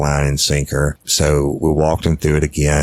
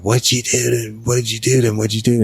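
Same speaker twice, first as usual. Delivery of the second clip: high-pitched retold panic, repeated question; imitating her freaking out; 8 semitones higher, faster than usual. Retelling the mother finding the baby, imitating her freak-out. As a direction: mimic a frantic woman demanding answers. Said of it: "she started freaking out".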